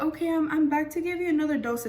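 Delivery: a hint of a breezy doctor, oblivious; cheerful doctor. Anesthesiologist returns with dose.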